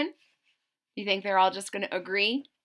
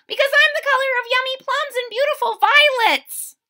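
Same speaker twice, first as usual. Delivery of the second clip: very high, louder insistent leaf voice; squeaky leaf; 8 dB louder than usual. Acted. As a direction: cut in to claim the top. Purple Beech voiced in story.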